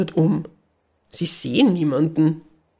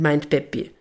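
ordinary delivery

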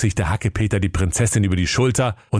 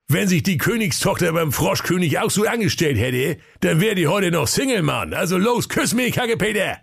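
high, mocking workman voice; cheeky workman, teasing him; cheeky sewer worker; 9 semitones higher than usual. (frecher Kanalarbeiter, spöttisch)

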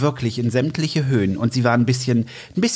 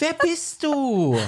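high, slow silly voice; put-on high voice; 13 semitones higher, much slower than usual. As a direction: ask it like a cartoon figure. Verstellte Stimme, Rollenspiel. (hohe, langsam gedehnte Quatschstimme)

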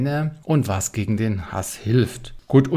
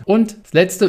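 usual speaking voice

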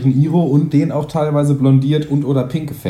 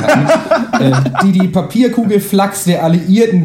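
grand mock-epic storytelling voice; epic fantasy narrator; slower than usual. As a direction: narrate like a war chronicle. S0 reads mock-epic roast story.

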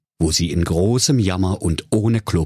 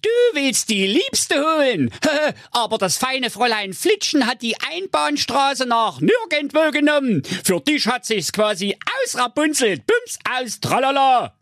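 much higher mocking witch voice; scornful witch. The witch taunting Enrico, high mocking voice. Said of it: "rief sie höhnisch".

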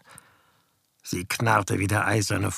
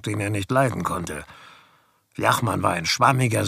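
ordinary delivery

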